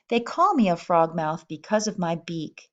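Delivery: ordinary talk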